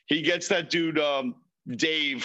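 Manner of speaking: usual speaking voice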